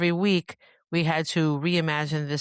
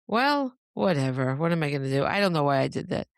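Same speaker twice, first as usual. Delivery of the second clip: ordinary talk, nothing marked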